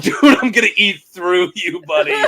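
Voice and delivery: loud, laughing, hammed-up brute taunt; mock trash talk. Giant fighter taunting a fencer, loud mocking. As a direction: gloat over a tiny opponent, slowing on the last words. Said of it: "you're like".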